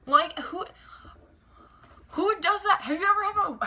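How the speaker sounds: who does that, incredulous. Real, not acted. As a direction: pitch jumps high in disbelief. Outraged rhetorical question.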